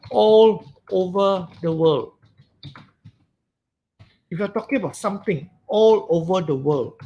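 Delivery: ordinary talk, nothing marked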